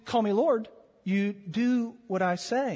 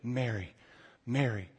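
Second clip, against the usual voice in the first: tender, hushed MARY, each one weighted; heartfelt emphasis; 7 semitones lower, 7 dB quieter than usual. Shown as a demonstration. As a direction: say MARY softly and low, pausing before the repeat. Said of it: "supposed to put real emphasis on it".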